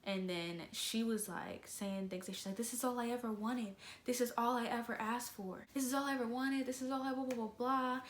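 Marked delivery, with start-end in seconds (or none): 2.5-8.0 higher-pitched mock ranting; mock tirade trailing into blah-blah; mimicking a complaint. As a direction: imitate her repetitive complaining, pitched up. Mimics the arguing woman's repeated complaint.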